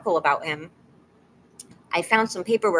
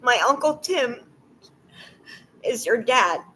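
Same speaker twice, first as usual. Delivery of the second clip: higher voice, close to tears; real crying while reading; 5 semitones higher than usual. Real, not acted. Reading a cousin's message about a dad, tearfully. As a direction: struggle through the words tearfully. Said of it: "but I cried".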